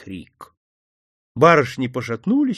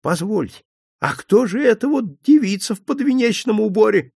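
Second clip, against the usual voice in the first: a mild tremble, rising in pitch; trembling voice; 8 semitones higher than usual. Acted. Old priest questioning the bride, lightly trembling. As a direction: ask with a shaky old man's alarm. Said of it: "трясущийся, вздрагивающий голос батюшки".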